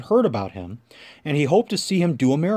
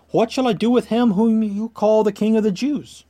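higher, pressing question to a crowd; insistent questioning; 7 semitones higher, 3 dB louder than usual. A narrator's reading. Pilate appeals to the crowd.

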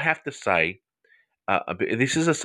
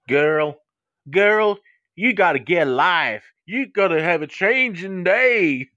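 high, drawn-out mock scolding; sassy Texan counsellor; 8 semitones higher, slower than usual. Host teasing an avid TV watcher, hammed-up drawl. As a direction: lay it on thick with a Southern drawl.

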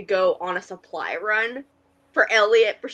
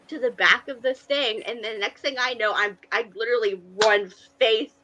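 ordinary talk

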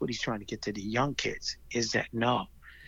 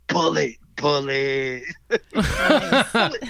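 slow drawn name with laughter; laughing; 7 dB louder, much slower than usual. Real, not acted. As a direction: chuckle the name fondly. Laughing at the nickname.

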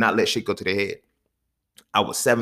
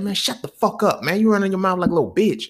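put-on heated teenage snap at his brother, higher; mock tough comeback, raised pitch; angry younger brother talking back; angry retort; 8 semitones higher than usual. Retold fight with older brother.

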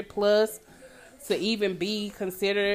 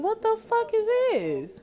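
disbelief; 12 semitones higher, 3 dB quieter than usual. Retold or imitated. Quoting own reaction.

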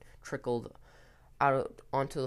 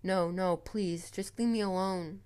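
desperate plea; 6 semitones higher than usual. Acted. Ethan begging the club members at his bedside, desperate plea. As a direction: beg them to back off, voice raised.

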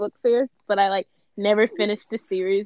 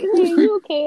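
high, loud check-in question; imitated concerned teacher; 8 semitones higher, 7 dB louder than usual. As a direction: check on a child in a put-on teacher voice. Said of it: "the teacher would be like".

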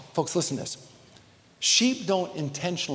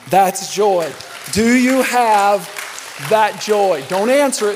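louder, slow, rising joy; loud preaching; 5 semitones higher, 9 dB louder, slower than usual. Real, not acted. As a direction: call it out to the room with fire. Pastor rising to climax.

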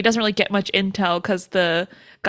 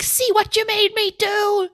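very high-pitched mock shriek; imitated scream; 12 semitones higher than usual. Annie screaming after sloshing the soup, imitated shriek. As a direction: screech the blame at him, hammed up. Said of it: "she's screaming".

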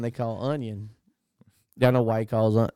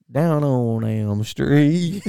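slow mock title; much slower than usual.

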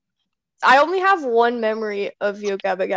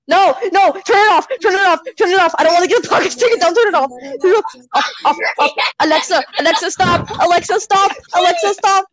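urgent shouting; 5 semitones higher than usual. Real, not acted. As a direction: yell to stop the speaker fast. Panicking to stop music playing.

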